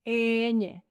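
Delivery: Ñ said slowly as a long "eeeh-nyeh"; slow, level Spanish "Ñ" for learners; drawn-out letter name. Shown as a demonstration. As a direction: stretch the first vowel of "Ñ". Letter name drawn out, then normal.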